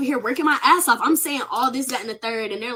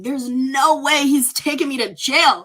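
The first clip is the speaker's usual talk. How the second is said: retold crying; 3 semitones higher, 5 dB louder, slower than usual. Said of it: "I'm, like, crying".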